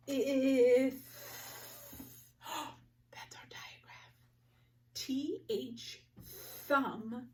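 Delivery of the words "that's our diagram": hushed, surprised whisper to the class; whispered teacher aside; excited whisper. Acted. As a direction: share a secret discovery.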